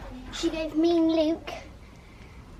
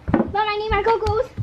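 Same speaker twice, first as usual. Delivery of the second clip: calling out; 4 semitones higher, 6 dB louder than usual. Real, not acted. Child calling to mum across pool.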